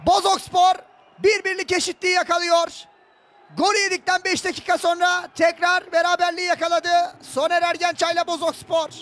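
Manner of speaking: high, loud excited goal call; goal celebration shout. Real, not acted. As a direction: scream the goal for the home side.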